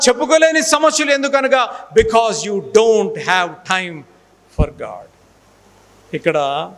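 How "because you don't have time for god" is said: slow dramatic drop. Acted. (నెమ్మదిగా నాటకీయంగా దిగే స్వరం)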